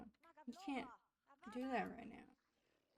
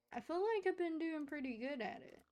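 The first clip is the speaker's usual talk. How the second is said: proud; 6 semitones higher, 4 dB louder, faster than usual. Real, not acted. Proud of her house building.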